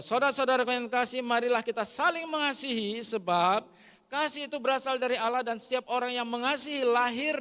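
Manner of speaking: pembacaan ayat. Real, not acted. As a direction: proclaim the verse at full force, voice lifted high. Reads the verse aloud forcefully. (membaca ayat dengan suara lantang)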